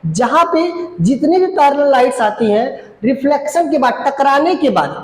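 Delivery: ordinary talk, nothing marked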